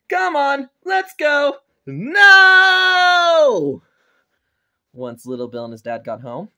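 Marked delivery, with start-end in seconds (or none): 0.1-1.6 high, raised-voice hurrying along; impatient order. Acted. Father hauls son home. 1.9-3.8 long, high, loud no; screamed refusal. Acted. Child refuses to go home.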